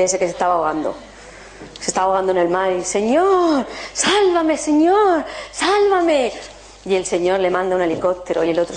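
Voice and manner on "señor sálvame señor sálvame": pleading cry. Retold or imitated. Drowning man begging the Lord, high pleading. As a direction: plead desperately to be saved.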